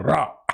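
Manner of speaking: "Ra" with a strongly rolled R; Mauritanian-style R. Shown as a demonstration. Teacher telling students to copy the Mauritanian, rolled R demo. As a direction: roll the R of "Ra" like a Mauritanian. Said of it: "essayez de faire comme la Mauritanie".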